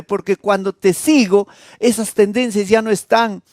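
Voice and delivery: high, impassioned preaching voice; fervent preaching. Acted. Peter confesses to the Lord, fervent high plea. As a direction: plead it with full fervor.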